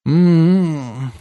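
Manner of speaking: high, languid "mmm"; lazy drawn-out hum. A narrator's reading. Woman in bed answering her lover, humming lazily. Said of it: "лениво протянула она".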